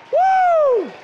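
high, loud whoop of praise; joyful whoop. Real, not acted. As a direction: let out a celebrating woo. Shout of joy in preaching.

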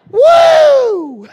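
high, loud, drawn-out "woo"; piercing holler of praise; Pentecostal whoop. Shown as a demonstration. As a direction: let out a revival-meeting whoop. Mimics Pentecostal church outburst.

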